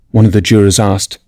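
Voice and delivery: ordinary delivery, nothing marked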